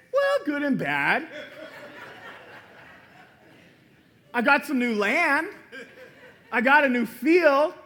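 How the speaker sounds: a husband hiding bad news behind a laugh; husband laughing nervously while confessing to his wife; laughing sheepish husband. Acted husband answer, laughing.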